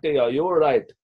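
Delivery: put-on light Indian accent asking if you're all right; a hint of an Indian crew member's accent, checking on him. Film crew member calling to the fallen actor, lightly Indian-accented. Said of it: "Sorry about the accent".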